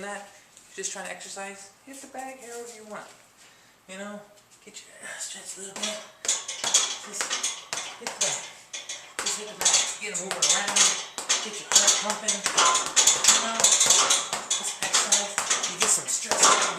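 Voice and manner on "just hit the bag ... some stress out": louder, higher, effortful speech; exerting while punching. Real, not acted. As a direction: talk through hard physical effort. Talking while punching the bag.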